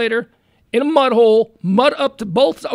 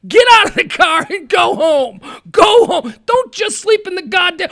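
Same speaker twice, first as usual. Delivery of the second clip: louder and higher, scolding the fools; exasperated yelling; 5 semitones higher, 5 dB louder than usual. Real, not acted. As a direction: yell it like scolding an idiot. Ranting at the dead men.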